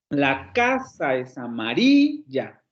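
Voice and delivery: "casa" and "amarilla" stressed, no break after "casa", slow; smooth run, stress on casa and amarilla. Shown as a demonstration. Teacher models the sentence slowly for a student, stress on casa and amarilla. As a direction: say "la casa es amarilla" slowly in one flowing phrase. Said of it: "Cuando lo dices de manera corrida".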